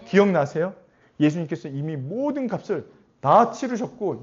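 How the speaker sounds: usual speaking voice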